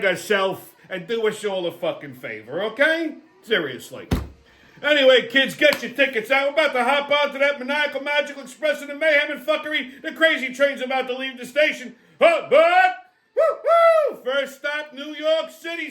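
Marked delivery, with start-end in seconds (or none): 4.8-12.0 high, excited carnival-style train conductor. Pretend. Train conductor bit calling passengers aboard. 12.2-14.2 high, loud hooting train whistle; train whistle imitation. 14.2-15.9 high-pitched mock station call; train conductor. Conductor announces the first stop.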